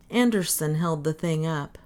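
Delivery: ordinary delivery, nothing marked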